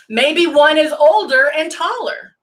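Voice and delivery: usual speaking voice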